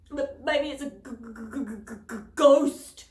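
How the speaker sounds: stuttering in fright, bursting loud on ghost; chattering teeth. A narrator's reading. Scared boy in the tunnel stammers through chattering teeth. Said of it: "through chattering teeth".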